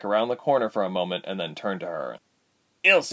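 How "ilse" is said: nervous, higher call of her name. A narrator's reading. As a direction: get her attention nervously, short and loud. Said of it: "he said nervously".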